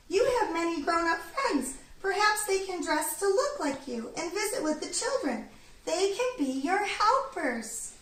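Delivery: little bird offering the idea; helpful bird. A narrator's reading.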